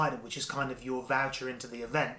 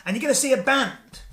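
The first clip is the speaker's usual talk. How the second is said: higher, louder game-show host; telling wife her Paris prize, hyped host voice; excited announcer; 7 semitones higher, 4 dB louder than usual. Retold or imitated. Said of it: "I go".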